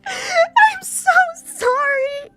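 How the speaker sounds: very high, loud, slow wailing sobs; sobbing. Acted. Emmy sobbing apologies against Hisao's chest, wailing. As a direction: bawl it out between gasps, stretching words. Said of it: "begins to sob against my chest".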